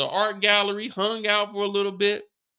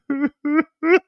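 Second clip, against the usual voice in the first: high burst of laughter; 10 semitones higher, 6 dB louder than usual. Retold or imitated. Laugh after Mireya line.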